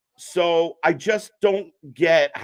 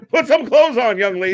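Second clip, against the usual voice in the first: stern parent; 7 semitones higher, 6 dB louder than usual. Pretend. Mock parent scolding.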